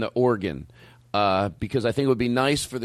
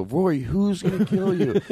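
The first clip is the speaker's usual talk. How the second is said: higher, slow mock-concerned question; retold pleading question; 7 semitones higher, slower than usual. Host retelling his voicemails to Rory, drawn-out mock pleading. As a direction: draw out the question, pitched up. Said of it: "it's, I go".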